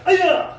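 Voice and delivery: very loud piercing high yell; kiai shout. Shown as a demonstration. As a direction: explode the yell on the break. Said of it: "warning key up".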